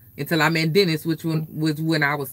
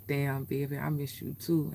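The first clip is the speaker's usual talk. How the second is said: deep radio voice; 3 semitones lower, 11 dB quieter than usual. Retold or imitated. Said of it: "he was like".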